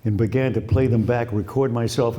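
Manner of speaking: usual speaking voice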